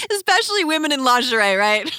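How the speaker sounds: teasing through laughter; laughing. Real, not acted.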